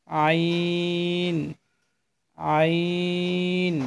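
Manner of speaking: each আইন drawn out long from the throat; teacher modelling আইন slowly; slow letter demo. Shown as a demonstration. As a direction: stretch আইন slowly for learners. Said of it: "এই আইন হরফটি উচ্চারণ করব".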